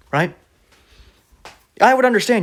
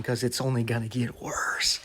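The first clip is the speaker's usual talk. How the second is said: whispered warning, no voice at all; mock ominous whisper; 4 semitones lower, 11 dB quieter than usual. Preacher warning viewers in a whisper. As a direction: breathe it like a spooky threat.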